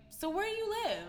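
high, chirpy boss voice; mock boss asking a question; imitating the boss. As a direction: pitch way up to mimic the clueless boss. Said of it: "She's like".

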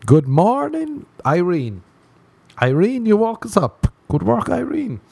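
squeaky, delighted wake-up call; the brain talking. Acted. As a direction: chirp it in a silly high voice. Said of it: "my brain will go".

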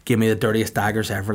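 ordinary delivery

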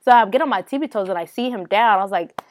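ordinary delivery

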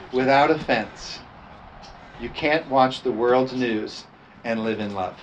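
ordinary delivery

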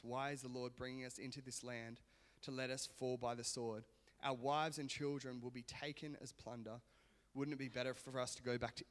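soft, even Bible reading voice; scripture reading. Acted. Pastor reading Numbers to the church, soft reading. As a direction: read the passage quietly to the congregation. Reads scripture passage aloud.

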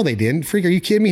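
ordinary talk

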